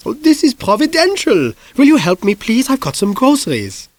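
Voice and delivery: high-pitched lady coaxing for help; Mrs Lysander's voice. Acted. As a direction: play the neighbour asking a favour, high and sugary.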